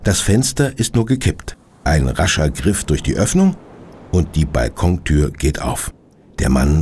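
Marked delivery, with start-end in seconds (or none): none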